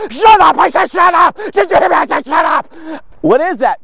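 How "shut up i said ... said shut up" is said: loud, higher-pitched furious shouting; repeated angry yell, acted out; imitated angry screaming. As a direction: shout down the kid, imitating an enraged lifter. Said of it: "screaming at the top of his lungs".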